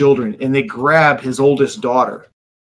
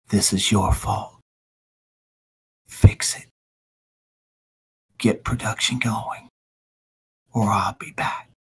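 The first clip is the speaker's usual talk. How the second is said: quiet menacing villain; 4 semitones lower, 6 dB quieter than usual. Acted. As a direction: lean in and menace him softly. Telepathic threat in minds.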